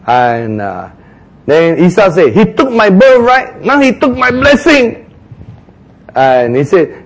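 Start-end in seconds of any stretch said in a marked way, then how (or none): 2.3-5.1 higher, wounded protest; aggrieved complaint. Acted. Esau complaining to Isaac about his brother, aggrieved. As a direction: complain bitterly of being cheated.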